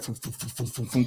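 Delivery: string of soft puffed "fum" sounds, low; imitated whooshing thuds. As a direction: puff out low, repeated thumps. Imitates stories rushing back.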